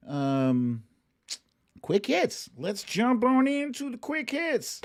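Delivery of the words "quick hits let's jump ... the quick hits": high, stretched announcer voice for the segment; hyped segment announcer. Acted. Host kicking off the quick hits segment, hammed-up announcer voice. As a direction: sell the segment like a game-show host.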